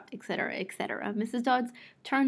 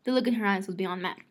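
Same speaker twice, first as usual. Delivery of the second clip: ordinary delivery, nothing marked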